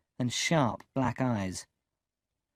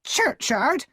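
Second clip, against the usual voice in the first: much higher, louder sharp question; sharp repeat; 13 semitones higher, 6 dB louder than usual. A narrator's reading. Sister repeating his answer, sharp and angry. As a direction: snap the word back at him. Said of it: "repeated sharply".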